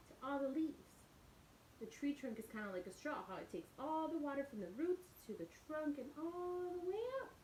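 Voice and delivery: plain reading voice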